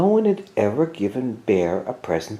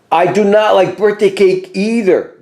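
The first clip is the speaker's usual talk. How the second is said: higher, louder announcement; announced loudly; 8 semitones higher, 5 dB louder than usual. A narrator's reading. Bear announcing for hidden Mouse to hear, loud and raised. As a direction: call it out so Mouse can hear. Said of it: "Bear announced loudly".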